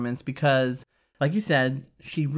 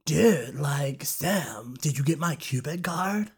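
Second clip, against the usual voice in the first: surfer stoner voice; slower than usual. Retold or imitated. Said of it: "They all sounded like surfer stoners".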